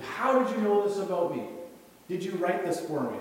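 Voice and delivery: high, loud accusing yell; retold yelling. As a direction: imitate a heckler yelling up at the preacher. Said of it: "he starts yelling at me".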